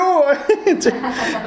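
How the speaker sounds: short real chuckle; amused laugh. Real, not acted. Preacher laughing at his own Spanish joke.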